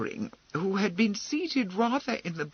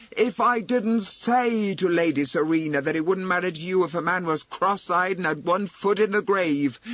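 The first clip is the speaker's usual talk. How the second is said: forceful; 5 dB louder than usual. Acted. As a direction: lay into them, unable to hold back. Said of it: "said forcefully".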